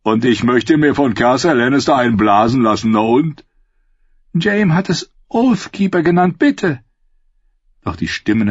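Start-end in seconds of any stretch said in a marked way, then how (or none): 0.0-3.4 coarse mocking character voice; dismissive taunt, a bit higher; jeering. Second jeering voice in her dream. 4.3-6.8 very high, anguished voice; high-pitched tearful plea; desperate pleading. Acted. Weeping plea for her sword.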